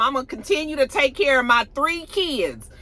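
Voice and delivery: imitated fed-up retort, high and emphatic; frustrated. Keyshawn tells Derek off about caring for kids, frustrated. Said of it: "She's so frustrated".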